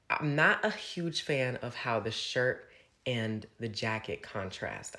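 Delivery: normal talking voice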